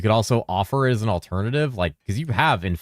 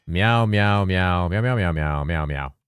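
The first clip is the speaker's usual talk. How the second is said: meowing on request; slower than usual. Pretend. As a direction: keep meowing to test the detector. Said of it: "should meow more often".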